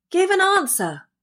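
high-pitched demand; crying out. A narrator's reading. Boy demanding a reply from the silent figure, crying out. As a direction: call out sharply, pitched well up. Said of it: "cried the boy".

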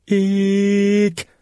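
high, sharp startled shriek; a sudden piercing squeal of fright; shrill shriek. A narrator's reading. A lady shrieks at the scouts in shock. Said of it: "joku kiljaisi".

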